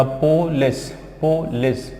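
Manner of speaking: "पोलिस" slowly as "po ... les"; broken into syllables. Shown as a demonstration. Teacher spelling out the sounds, "पोलिस" in two parts. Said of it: "और ये लेस".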